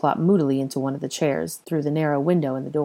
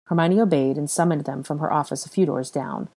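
usual speaking voice

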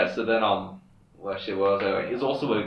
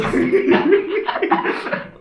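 squeaky breathless laugh; high wheezing laugh; 6 dB louder than usual. Real, not acted. Laughs hard after punchline.

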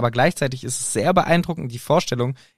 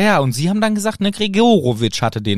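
brisk, pushy interviewer; Harry interview mode; 6 semitones higher, 3 dB louder than usual. Retold or imitated. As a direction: fire off quick questions. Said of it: "den krassen Harry-Modus, wie er sagt". (schneller Interviewer-Ton)

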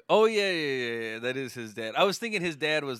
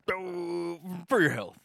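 Steve Brule voice; 5 semitones higher, slower than usual. Retold or imitated. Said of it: "then be like".

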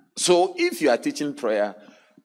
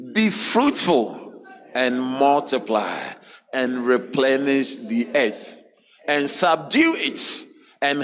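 scripture reading; 4 semitones higher, 5 dB louder than usual. Acted. Reading scripture aloud together.

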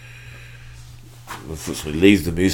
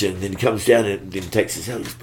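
ordinary talk, nothing marked

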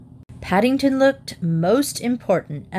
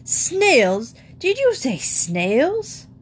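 high, drawn-out horrified question; aghast, stretched and rising at the end; incredulous exclamation; 7 semitones higher, much slower than usual. Acted. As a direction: swoop up in slow disbelief. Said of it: "exclaimed Mister Brown".

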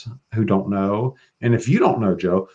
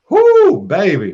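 excited whoop; 9 semitones higher, 7 dB louder, slower than usual. Real, not acted. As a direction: whoop it up over a crush. Gushing about the demon Paimon.

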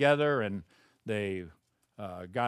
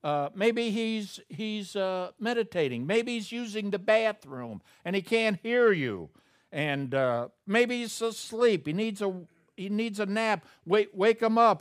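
high, loud taunting, much higher voice; mocking sarcasm; 9 semitones higher, 5 dB louder than usual. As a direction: needle them like a playground teaser. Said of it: "Elijah mocked them".

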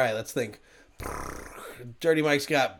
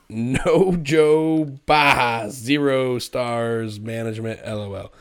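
slow; slower than usual. Acted. Reading the review mockingly.

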